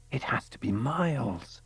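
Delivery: breathless awed whisper; whispered thought. A narrator's reading. Elora thinking in a hushed whisper. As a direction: whisper it to yourself in wonder.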